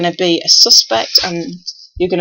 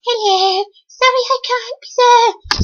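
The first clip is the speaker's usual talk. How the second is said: much higher, squeaky put-on voice; John's voice again. Repeating John's apology in a much higher squeaky voice. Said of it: "So John can't come either today".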